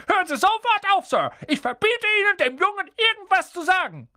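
high, strained shouted order; frantic, high-pitched uncle yelling; commanding. A narrator's reading. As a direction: forbid it at the top of your voice. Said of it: "befahl er".

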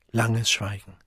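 ordinary delivery

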